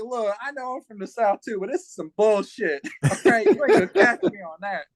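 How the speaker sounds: high, loud, laughing push-back; laughing retort. Retold or imitated. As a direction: laugh it off but shut him down.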